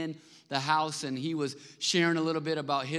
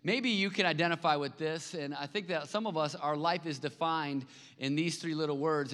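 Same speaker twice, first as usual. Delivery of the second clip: ordinary talk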